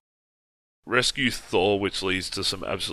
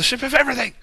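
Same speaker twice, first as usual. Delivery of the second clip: excited exclamation; 4 dB louder, much faster than usual. Real, not acted. Exclaiming in amused disbelief.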